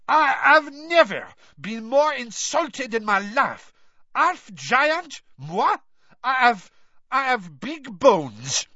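high, loud, slow French fury; indignant offended shouting; outraged. A narrator's reading. Maxime insulted, storming.

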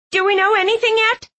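high, anxious woman's voice; worried Twyla voice. Acted.

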